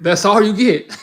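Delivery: loud laughing come-on; laughing flirt demo. Shown as a demonstration. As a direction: giggle it out coyly. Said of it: "I'm gonna show you again".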